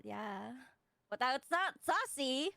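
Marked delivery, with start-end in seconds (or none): 0.0-0.5 breathy voice. Retold or imitated. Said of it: "You did it in the voice".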